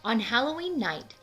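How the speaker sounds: ordinary delivery, nothing marked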